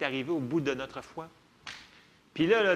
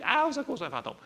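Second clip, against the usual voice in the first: mock frightened cry; 8 semitones higher, faster than usual. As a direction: squeal it like a scared disciple. Disciples crying out in fright. (cri de panique joué, aigu puis retombant)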